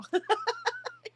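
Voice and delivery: sudden high-pitched laugh; real high laugh. Real, not acted. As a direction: laugh out at the joke. Laughs at chat joke.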